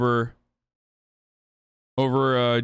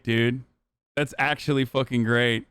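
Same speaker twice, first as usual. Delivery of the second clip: ordinary talk, nothing marked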